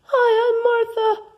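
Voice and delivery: squeaky high-pitched character voice. Acted. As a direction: pitch way up, cartoon host. Improv co-host introduces herself.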